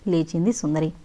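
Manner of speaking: ordinary delivery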